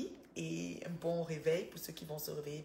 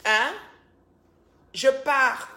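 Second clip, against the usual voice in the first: loud and high "Je pars"; mock loud exit; 7 semitones higher, 17 dB louder, much slower than usual. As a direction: announce "Je pars" loudly as a joke. Repeats the line louder.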